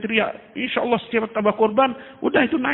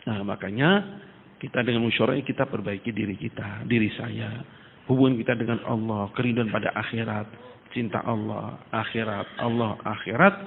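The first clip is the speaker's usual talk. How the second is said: much lower and softer, tender preaching; soft earnest exhortation; 11 semitones lower, 7 dB quieter than usual. Acted. Preacher urging the gathering to love of Allah, hushed and tender. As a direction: drop to a hushed, heartfelt plea.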